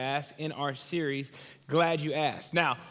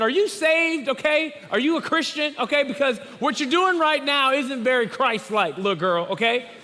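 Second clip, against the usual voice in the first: higher, louder mock preacher scolding; 7 semitones higher, 5 dB louder than usual. Pastor retelling how he scolded his niece, higher and louder. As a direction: playfully lecture the kid on being Christ-like. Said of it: "I said".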